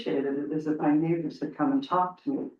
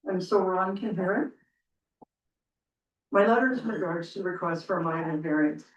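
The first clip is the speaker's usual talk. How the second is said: normal talking voice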